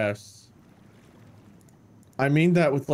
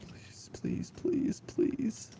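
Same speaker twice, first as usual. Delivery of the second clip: whispered pleading; 11 semitones higher, 11 dB quieter, much slower than usual. Acted. Scared pleading in game.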